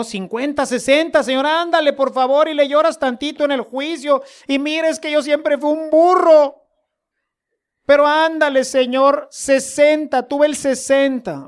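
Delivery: high, begging bargain with the Lord; pleading sinner. Pretend.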